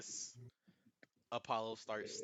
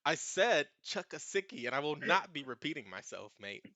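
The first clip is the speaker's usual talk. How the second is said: mock stern Australian; 5 semitones higher, 8 dB louder than usual. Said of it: "He goes".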